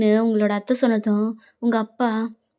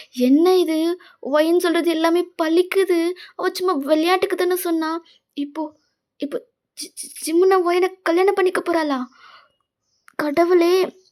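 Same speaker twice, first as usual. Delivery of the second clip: higher-pitched, stammering astonishment; shocked disbelief; 5 semitones higher than usual. Acted. Friend stunned that her joke is coming true, shocked. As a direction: gasp it out in a high voice, tripping on the name. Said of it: "அப்படின்னு அவ ஷாக்ல நின்னுட்டு இருக்கா".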